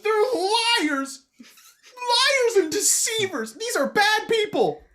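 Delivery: put-on high, slow, accusing child voice; outraged son. Son condemns the deceivers to his dad, high and slow. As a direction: spit out each word like a verdict.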